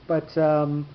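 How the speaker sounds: ordinary talk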